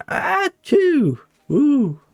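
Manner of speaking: high-pitched mock sneeze with a whoop after; comic impersonated achoo; imitating mum's sneeze. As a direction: mimic a sneeze ending in a high "ooh". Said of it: "My mum used to sneeze like that".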